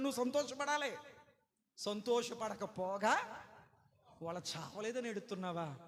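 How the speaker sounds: ordinary talk, nothing marked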